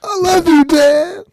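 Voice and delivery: high wailing mock cry, loud; mock sobbing. Host mocks the crying scene, hammed-up wailing. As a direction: wail it like a hammed-up sob.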